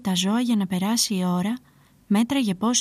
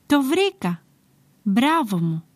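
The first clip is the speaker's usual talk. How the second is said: eureka excitement; 2 semitones higher, slower than usual. A narrator's reading. Bored shepherd congratulating himself on a prank idea, excited. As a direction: exclaim it like a sudden bright idea.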